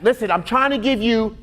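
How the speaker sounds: ordinary talk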